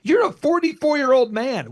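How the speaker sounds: high, loud mock scolding; hammed-up nagging relative. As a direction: imitate a nagging family member. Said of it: "They go".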